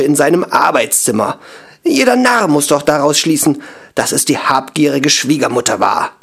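furious at the doubt; outraged. A narrator's reading. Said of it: "Vor Empörung grün im Gesicht".

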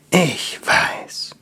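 tender whispered comfort. Acted. Repeats while stroking her hair.

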